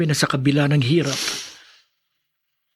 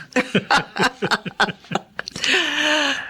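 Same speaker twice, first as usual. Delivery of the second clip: high playful laugh; 14 semitones higher than usual. Acted. Roman laughs at own joke.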